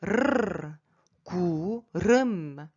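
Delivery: long trilled R, then 구름 with the same roll; drawn-out 구름 with rolled R. Shown as a demonstration. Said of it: "Il faut rouler un peu".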